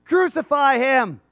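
loud, high, slow mob cry; daring shout. Acted. Crowd daring God at the cross, loud shout. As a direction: shout it out like a dare. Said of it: "that was really a dare".

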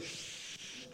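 long soft imitated shush. Preacher imitating someone he hates, drawn-out shush. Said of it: "when someone goes".

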